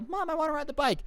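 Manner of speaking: put-on high-pitched little-kid voice. Karen's little kid asking mom to ride the bike, mock childish. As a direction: imitate a small child asking mom for something. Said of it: "Karen's kids, you know, when they were little".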